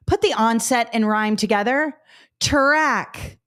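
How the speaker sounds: patient drill voice; teacher demo. Shown as a demonstration. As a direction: lead the class step by step. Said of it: "Do you see how we just did".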